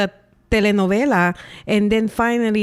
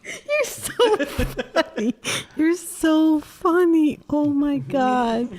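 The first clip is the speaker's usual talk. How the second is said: giggling through the words; slow, breathless laughing speech; 6 semitones higher, much slower than usual. Real, not acted. Guest laughing hard.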